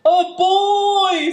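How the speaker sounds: loud, high storybook scream; bear's scream. A narrator's reading. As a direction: scream it as a startled bear. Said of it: "screamed the bear".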